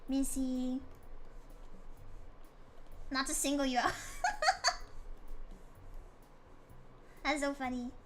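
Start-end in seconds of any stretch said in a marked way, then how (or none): 4.0-5.5 laughs. Real, not acted. Laughing at chat.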